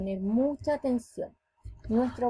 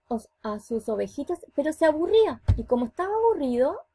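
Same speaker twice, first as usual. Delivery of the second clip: plain reading voice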